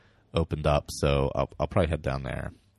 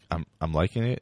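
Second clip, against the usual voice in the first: usual speaking voice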